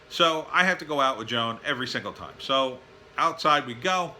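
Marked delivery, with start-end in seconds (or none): none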